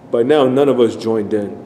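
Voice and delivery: ordinary delivery, nothing marked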